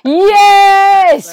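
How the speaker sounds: loud, high cheer of yes; host cheering the guest's pick; excited yes. Real, not acted. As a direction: punch the air as she hears Bergkamp. Host cheers the Bergkamp answer.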